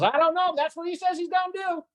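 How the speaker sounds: very high, held-up Southern imitation; squeaky-high mock Southern drawl. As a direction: mimic a Southern buddy pleading ignorance. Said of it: "a real, real Southern drawl".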